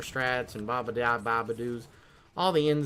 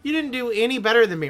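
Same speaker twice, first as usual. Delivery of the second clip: annoyed retort; 9 semitones higher, 4 dB louder than usual. Retold or imitated. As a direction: snap back in disbelief. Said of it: "And I was just like".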